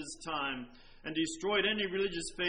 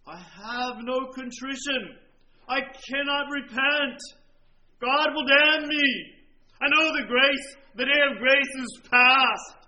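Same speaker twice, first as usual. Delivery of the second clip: dying man's cry; 4 semitones higher, 9 dB louder than usual. Retold or imitated. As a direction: cry it out as the damned man. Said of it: "he said".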